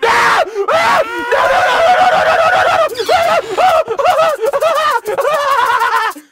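very high, loud frantic screams; screaming in terror. Real, not acted. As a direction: shriek no over and over, terrified. Jump scare, being chased.

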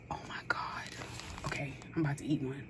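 excited gasp then fast whisper; breathless whisper of anticipation. Real, not acted. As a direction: hush it, barely containing the thrill. About to eat the brownie.

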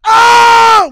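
huge high-pitched yell of delight; excited shout. Retold or imitated. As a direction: scream like starstruck teens. High school kids meeting Tim Meadows.

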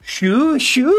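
high, drawn-out nickname call; silly high call to a viewer; playful cry. Real, not acted. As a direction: stretch it out up high. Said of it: "Não grita, foi mal".

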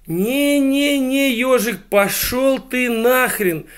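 very high, loud, drawn-out refusal; exasperated shout. Acted. Ali Baba sends the hedgehog packing, shouting in exasperation. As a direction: cut him off with a hammed-up, slow yell.

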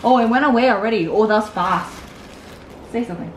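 normal talking voice

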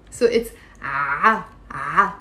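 "A" slow, low, pressed in the throat; ain with fatha. Shown as a demonstration. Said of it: "This one has a fatha".

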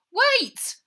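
high, loud call from afar; shouted wait. Acted. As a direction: yell it across the tarmac. Harry shouting while running.

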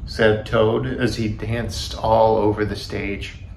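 ordinary delivery

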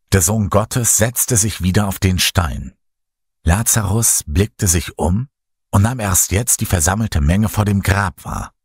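ordinary delivery

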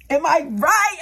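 loud, high call to the crowd; mock shout. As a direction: shout it out for agreement. Shouting to audience.

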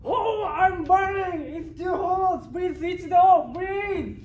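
high, loud, panicked begging; desperate scream. Acted. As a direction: scream to be switched off. Pot begs to be switched off.

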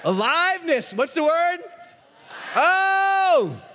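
shouted high and loud to the room; crowd-rousing shout. Real, not acted. Speaker hyping the Growth Day audience, shouting. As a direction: call it out, demand the answer.

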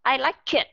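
"I like it" said as "I like kit"; wrong over-linking. Shown as a demonstration. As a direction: move a hard K onto the start of "it". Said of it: "các bạn đưa sang bên này". ("I like it" đưa âm K sang thành "kit")

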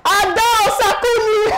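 very high, stretched-out name call; imitated calling out. Prefect calling a student for visiting day, high imitated call. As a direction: sing the name out high, as if across a hostel. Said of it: "Immediately I just heard".

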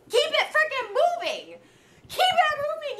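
slow, high, near-crying insistence; exasperated high plea. Real, not acted. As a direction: plead it through disbelief. Exasperated advice to Gabby.

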